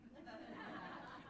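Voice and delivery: short breathy laugh rising high; a quick rising chuckle. Real, not acted. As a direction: break into an amused laugh. Laughs at own story.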